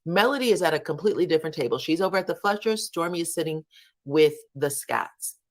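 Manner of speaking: usual speaking voice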